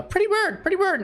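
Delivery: very high parrot voice; talking pet bird. Acted. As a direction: squeak it out like a parrot. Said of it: "the bird just goes".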